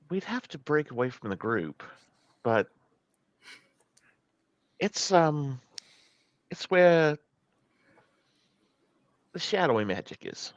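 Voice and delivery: soft, halting in-character explanation; hesitant confiding. Acted. Rowan explaining shadowy magic to Penelope, hesitant and quiet. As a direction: feel for the words quietly, with long pauses.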